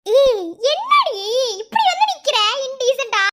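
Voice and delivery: loud, high, drawn-out outcry; scandalised scolding. Acted. Woman shocked at how her friend is standing, scandalised. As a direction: cry out slowly in mock outrage.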